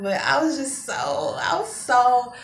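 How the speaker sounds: ordinary talk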